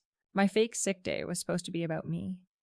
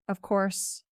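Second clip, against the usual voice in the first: usual speaking voice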